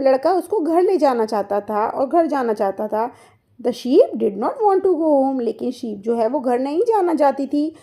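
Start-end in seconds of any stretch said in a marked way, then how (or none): 3.6-5.4 put-on very slow, word by word for children; slow classroom read-aloud; slow story reading. Shown as a demonstration. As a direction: read each word slowly so kids follow. Reads English story line to class.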